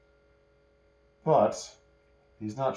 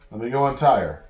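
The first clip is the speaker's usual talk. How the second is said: louder bluff; 4 semitones higher, 8 dB louder than usual. Acted. Cersei stalls the bandits.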